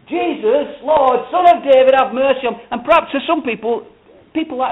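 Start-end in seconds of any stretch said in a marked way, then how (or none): 0.1-2.6 high, loud mock shouting; imitated bawling. Imitating two blind men shouting at Jesus, bawling. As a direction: bawl it out like a desperate beggar. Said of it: "bawling and shouting".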